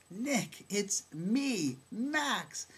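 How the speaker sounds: high, loud, punched word by word; emphatic insistence. Retold or imitated. Acquaintance finally makes the teller recognise him, emphatic insistence. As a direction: act out a last emphatic attempt to get through.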